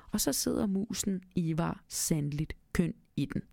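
usual speaking voice

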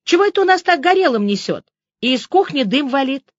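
high, loud, quick complaint; calling out. A narrator's reading. Liza shouting from the hallway about burning, calling out.

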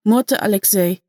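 ordinary delivery, nothing marked

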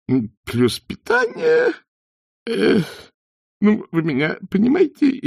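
very high, thin, strained voice; nervous falsetto; squeaky innkeeper. A narrator's reading. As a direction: keep squeaking as you grope for words. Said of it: "пропищал он неестественно тонким голоском".

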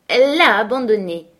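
loud, insistent cry; shouted. A narrator's reading. Young mistress contradicting Linton, shouting. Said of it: "cria ma jeune maîtresse".

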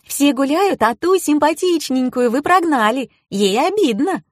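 put-on high-pitched girlish complaint; high voice of mild reproach; reproachful. A narrator's reading. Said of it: "укорила меня создательница клипов".

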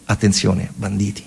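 ordinary talk, nothing marked

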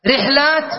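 رحلات as riḥ-lāt, no vowel after ḥ; middle consonant unvowelled. Shown as a demonstration. Teacher lists allowed plurals, رحلات as riḥ-lāt. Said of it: "إما تقول رحلات وهي أسهلها".

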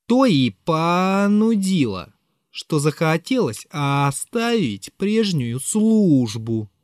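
slow, stretched drawl; drawn-out reply. Acted. Narrator answers Zverkov's question, slowly drawled out. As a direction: stretch every word out, barely in control. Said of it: "Протянул я втрое больше".

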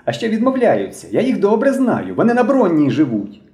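put-on high-pitched excited boy accusing; shouted accusation. A narrator's reading. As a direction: denounce them in a high, urgent voice. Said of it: "закричав Білобрисий".

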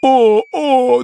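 high "oh, oh" wail; crocodile howl. Acted. Crocodile spun over the desert, high howl. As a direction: wail twice, high and helpless.

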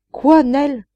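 high, loud cry of despair; family cries out in despair as she leaves. Acted. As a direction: cry out her name in disbelief. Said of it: "s'écrièrent-ils avec désespoir".

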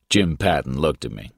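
ordinary delivery

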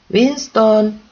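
loud, high call of a woman's name; a woman calling her dog in the dark. A narrator's reading. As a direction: call the dog from across the house. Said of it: "női hang".

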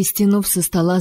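ordinary delivery

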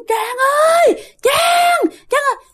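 very high piercing shrieks of the name; shrill ghost shrieks. Retold or imitated. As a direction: shriek the name like a ghost right beside her. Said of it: "lúc thì nó gần mà nó lanh lảnh". (tiếng ma thét lanh lảnh, rất cao)

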